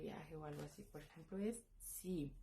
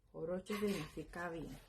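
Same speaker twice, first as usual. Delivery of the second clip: ordinary talk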